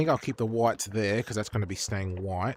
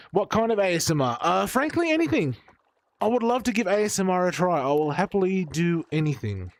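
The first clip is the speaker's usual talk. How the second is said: excited; 9 semitones higher, 5 dB louder than usual. Acted. Gushing about trying ASMR.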